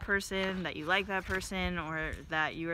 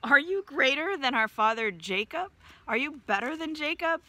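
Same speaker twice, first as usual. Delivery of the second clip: higher and louder, a mild mock taunt; mocking challenge; 6 semitones higher, 4 dB louder than usual. Woman at the well taunting Jesus about Jacob, mock challenge. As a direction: needle him with a lightly voiced jab. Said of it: "she continues with her insults".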